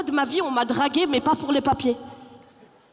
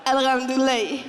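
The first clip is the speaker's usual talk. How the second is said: put-on Malian accent. Malian reply acted.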